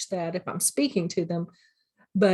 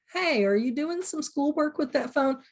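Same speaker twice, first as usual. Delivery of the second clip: higher, hesitant question instead of an order; tentative questioning; 6 semitones higher than usual. Shown as a demonstration. Teacher demoing weak talk to a phone-using student, tentative. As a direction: ask it timidly, avoiding the point.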